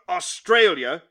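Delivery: Australia hit hard and loud; emphatic. Acted. Employer telling the sacked clerk where to go, emphatic. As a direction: land Australia like a blow. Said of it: "here he was very emphatic".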